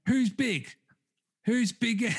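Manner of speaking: mock demanding shout-out; calling out. Streamer calling out the sender on her live, mock demanding. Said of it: "she's calling him out going".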